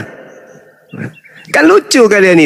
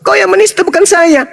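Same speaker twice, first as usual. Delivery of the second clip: sharply higher, pushing back hard; heated retort; 9 semitones higher than usual. Real, not acted. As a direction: throw the blame right back at him. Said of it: "retort raised in anger". (membalas tuduhan dengan nada tinggi)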